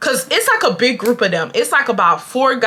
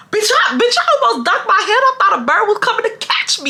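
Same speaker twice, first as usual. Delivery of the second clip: high-pitched, comically startled; mock alarm; 12 semitones higher than usual. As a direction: exclaim in exaggerated panic, pitch way up. Joking about her startled reaction.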